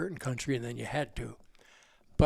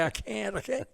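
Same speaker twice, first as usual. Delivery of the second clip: higher, softer, whining mockery; mock whiny refusal; 6 semitones higher, 5 dB quieter than usual. Retelling arguments over driving, a high whiny mock plea. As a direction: play the helpless kid begging off. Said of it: "I'd say".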